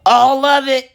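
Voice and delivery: put-on high loud kid yell; high, loud outraged kid. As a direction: shout it out like an outraged child. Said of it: "This fool yells out".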